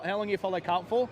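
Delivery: usual speaking voice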